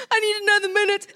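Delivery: put-on tearful child's plea through sobs; crying. Boy to his mom, crying. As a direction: choke it out like a crying little boy. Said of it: "he started crying".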